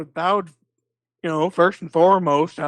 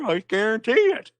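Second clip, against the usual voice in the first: ordinary delivery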